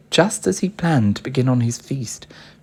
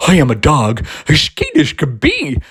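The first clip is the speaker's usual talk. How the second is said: eager, loud, bouncy dog voice; a keen dog begging to come along; big friendly dog pleading; 2 semitones higher, 7 dB louder than usual. A narrator's reading. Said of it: "as the witch pulled... then eagerly said".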